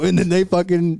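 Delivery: ordinary delivery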